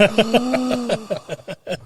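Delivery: long, high mock gasp; panicked gasp. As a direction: gasp as if caught out. Recruit panics at the question.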